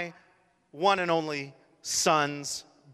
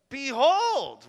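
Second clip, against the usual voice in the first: emphatic behold; 8 semitones higher, 6 dB louder than usual. Real, not acted. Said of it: "sermon climax".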